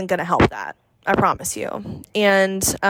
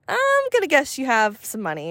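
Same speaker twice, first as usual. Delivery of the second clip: ordinary delivery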